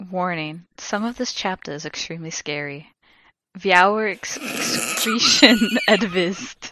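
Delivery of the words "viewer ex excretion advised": laughing mid-word. Real, not acted. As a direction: crack up reading. Laughing through the warning.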